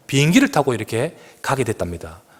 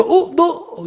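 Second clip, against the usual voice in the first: surprised recognition; much slower than usual. Retold or imitated. Meets his student on the plane.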